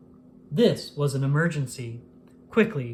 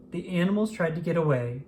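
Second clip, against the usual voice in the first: ordinary delivery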